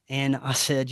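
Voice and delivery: ordinary talk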